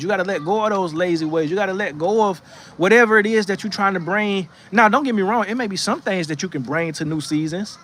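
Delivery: usual speaking voice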